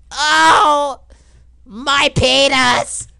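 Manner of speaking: high, loud, drawn-out wail; pained yell. Real, not acted. Player reacting to his character's injury, pained yell. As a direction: yell it slowly in mock agony. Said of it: "I don't mean to yell".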